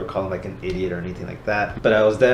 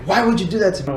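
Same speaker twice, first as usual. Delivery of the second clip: high, loud accusing outburst; mock yelling; 11 semitones higher, 5 dB louder, faster than usual. Confronting a friend who hurt him, acted yelling. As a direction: act out yelling at a friend who wronged you. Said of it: "I start yelling at you".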